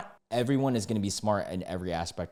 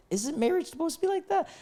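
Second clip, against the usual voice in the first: baffled squeak. Real, not acted. Unmarried commenter questioning what marriage is, baffled squeak. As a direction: voice shooting very high in disbelief.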